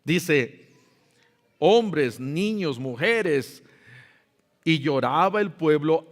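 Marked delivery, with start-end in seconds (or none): none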